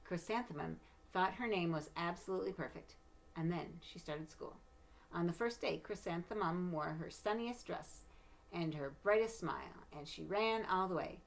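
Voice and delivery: storybook narration, gentle and lively; reading to children. Acted. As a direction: read it like a bedtime picture book. Reads picture book aloud.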